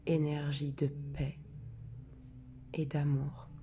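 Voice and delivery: hushed, prayer-like recitation of the intention; solemn invocation. Acted. Healer opening the sacred circle for the group, hushed and solemn.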